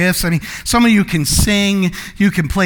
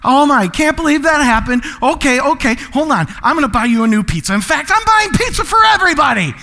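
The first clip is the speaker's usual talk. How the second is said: worked-up, higher-pitched flurry of generosity; eager giver; 4 semitones higher than usual. Acted. Acting the giver at a dropped pizza, excited. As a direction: build from flustered concern to a big excited offer. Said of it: "You're a giver".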